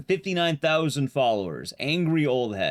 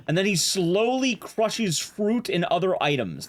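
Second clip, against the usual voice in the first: usual speaking voice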